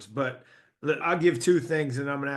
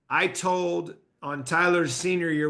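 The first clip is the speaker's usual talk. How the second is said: ordinary talk, nothing marked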